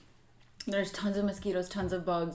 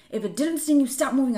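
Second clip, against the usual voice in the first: raised, higher urgent voice; urgent plea; 6 semitones higher, 6 dB louder than usual. Retold or imitated. Mother retells begging her daughter to stay still, loud. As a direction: make her freeze before the bee stings. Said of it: "I'm like".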